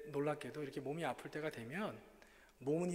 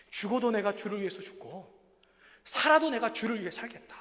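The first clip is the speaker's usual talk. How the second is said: bold proclamation; 6 semitones higher, 11 dB louder than usual. Acted. Preacher declaring his devotion to the congregation, loud. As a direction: proclaim it with fire.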